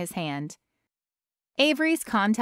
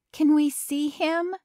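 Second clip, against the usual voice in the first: anxious mother; 8 semitones higher than usual. A narrator's reading. Worried mother asks doctor.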